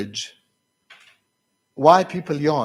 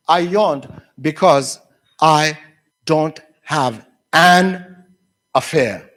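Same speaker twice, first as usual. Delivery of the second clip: slow, word by word with pauses; deliberate reading out; 5 dB louder, slower than usual. Retold or imitated. Teacher revealing what the students wrote, slow and deliberate. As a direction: spell out each word for comic reveal. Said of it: "Do you know what the students wrote".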